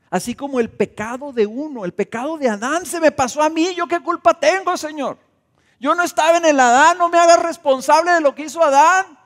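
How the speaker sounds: high, loud, indignant whine; mock protest to God. Sinner complaining to the Lord about Adam's sin, hammed-up indignant whine. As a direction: play the offended sinner protesting his innocence.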